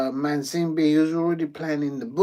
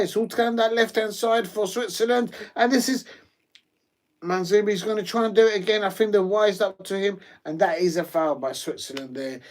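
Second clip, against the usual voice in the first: normal talking voice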